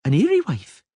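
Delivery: put-on high girlish voice, stubborn and petulant; a spoiled little princess making her case; young girl Ciri. A little princess arguing with the Witcher, high girlish voice. Ciri asks about dryad.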